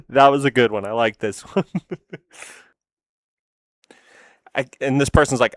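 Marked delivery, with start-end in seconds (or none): 1.7-2.2 short soft laugh. Real, not acted.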